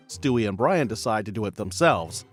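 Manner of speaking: normal talking voice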